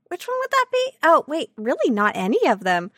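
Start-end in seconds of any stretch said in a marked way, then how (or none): 0.1-0.9 mock puzzled question.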